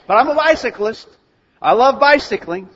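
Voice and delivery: high, loud protest of devotion; hammed-up cycling fanatic; insistent enthusiast. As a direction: insist eagerly against all evidence. Insists despite having no bicycle.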